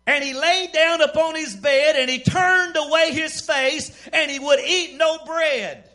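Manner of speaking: high, loud pulpit reading; preaching scripture reading. Real, not acted. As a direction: proclaim the verse to the whole church.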